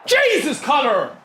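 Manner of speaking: sudden high exclamation at the screen; excited cry. Real, not acted. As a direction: burst out in real excitement. Reacting to a goal chance live.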